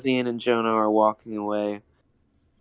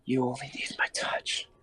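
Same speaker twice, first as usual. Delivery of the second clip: breathy seductive whisper; 2 semitones higher, 10 dB quieter than usual. Acted. Ian seduces Jonah, voiced.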